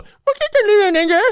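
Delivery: high, loud whiny third grader; whiny kid voice. Retold or imitated. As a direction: sneer it like a smug little kid. Mocking a third grader's retort.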